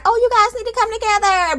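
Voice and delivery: high, sing-song peacemaker voice; mock peacemaker. Host mocking a pushy peacemaker, high and sing-song. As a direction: chirp it like a cheery mediator. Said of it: "I would keep pushing forward like".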